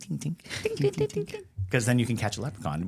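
soft high tinks of a hammer; tiny hammer tinks. Retold or imitated. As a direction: tap out tiny tinks, rising. Imitating tiny hammer sounds.

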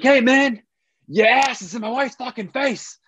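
a pushy New Yorker confronting a stranger; tough New Yorker. Retold or imitated. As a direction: bark it like an angry stocky New Yorker. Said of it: "the quintessential New Yorker kinda guy".

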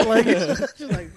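talking through laughter, slowly; words broken up by laughs; laughing. Real, not acted. Laughing while retelling.

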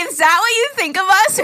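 high, loud amused protest; mock disbelief. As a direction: object in laughing disbelief. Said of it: "And I'm like".

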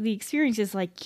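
ordinary talk, nothing marked

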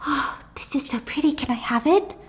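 hushed awe. Acted. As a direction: coo softly over a pretty thing. Mother admiring a stranger's things.

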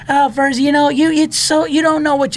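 high, loud mock Bill Burr deflecting praise; Bill Burr impression. Comic mimics Bill Burr waving off Patriots praise, high mocking voice. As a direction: squeak it out like Burr shrugging off a compliment.

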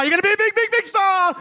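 high-pitched cheesy promoter; high sleazy hype voice. Acted. Sleazy producer hyping.

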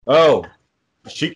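usual speaking voice

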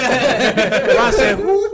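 high, loud laughing Who; laughing squeal. Retold or imitated. Mock LeBron not recognizing.

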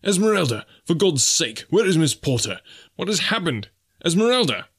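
high-pitched, frantic calling; urgent shriek. A narrator's reading. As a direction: shout desperate questions to rouse her. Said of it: "he shrieked in her ear".

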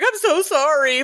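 mock tearful. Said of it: "he just bursts into tears".

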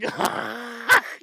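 exaggerated imitation of an odd laugh; mimicked weird laugh. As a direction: parody a ridiculous belly laugh. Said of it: "Who the fuck laughs like this".

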